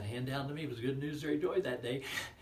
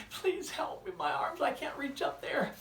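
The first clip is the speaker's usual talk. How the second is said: high, loud desperate plea for help; pleading child; 9 dB louder than usual. Retold or imitated. Stuck boy begging for help, high and loud. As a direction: cry up for help from below the cliff.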